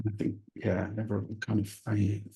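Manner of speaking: ordinary delivery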